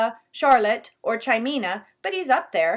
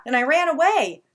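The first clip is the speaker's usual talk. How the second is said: put-on excited kid; 8 semitones higher, 10 dB louder than usual. Little brother retells fright.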